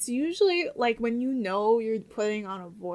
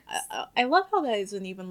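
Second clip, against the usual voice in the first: normal talking voice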